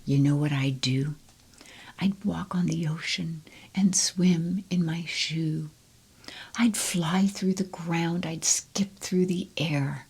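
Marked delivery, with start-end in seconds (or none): none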